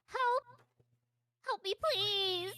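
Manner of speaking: high, loud pleading cry; child crying for help. Acted. Trapped girl calling to the listener, high pleading cry. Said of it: "You hear her go".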